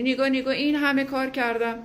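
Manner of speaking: mock plaintive tone of one who gave everything; self-pitying complaint. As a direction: mimic someone listing their sacrifices.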